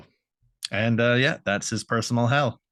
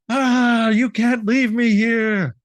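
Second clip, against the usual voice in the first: trapped villain wailing; 9 semitones higher than usual. Acted. Viking trapped in stone begs not to be left, hammed-up wail. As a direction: cry out high, dragging the words. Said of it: "as if he's trapped in the".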